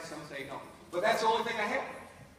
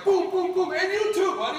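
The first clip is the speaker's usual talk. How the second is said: loud high booms then a taunt; imagined shooter; 12 semitones higher, 10 dB louder than usual. Retold or imitated. Imagining the neighbor shooting at him, loud taunt. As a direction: shout the gunfire, then taunt.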